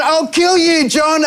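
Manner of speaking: high-pitched comic death threat; hammed-up angry vow; mock threat. Retold threat after falling in.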